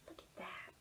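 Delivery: very soft, unvoiced; whispered aside. Real, not acted. Reader pointing at the page, whisper.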